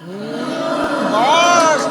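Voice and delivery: explosive, high, drawn-out hype yell; hyped shout. Real, not acted. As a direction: roar it out at a killer rap line. Hype reaction to played rap line. (pasigaw na tuwang-tuwa)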